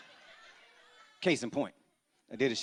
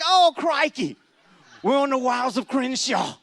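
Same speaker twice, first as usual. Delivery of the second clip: higher, louder Aussie wildlife-host excitement; Australian crocodile hunter; 9 semitones higher, 6 dB louder than usual. Retold or imitated. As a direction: narrate like an Australian nature documentary host.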